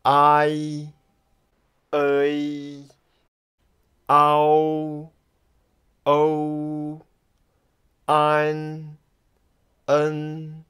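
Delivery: combined finals from Ao to Er held long and level; Pinyin pronunciation demo. Shown as a demonstration. Teacher shows the combined mothers, Pinyin demo. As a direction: glide evenly through each isolated Mandarin final. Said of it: "the combined one".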